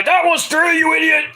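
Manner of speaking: high, strained protest, imitated; hammed-up outraged complaint; mock angry yell. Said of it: "He starts yelling at Paul Turner".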